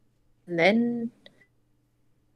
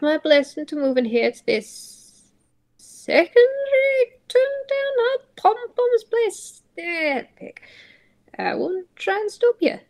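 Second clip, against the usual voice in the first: higher voice of a parent fighting tears to accept the move; tearful parent's blessing; 7 semitones higher than usual. Acted. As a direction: give your blessing while holding back tears.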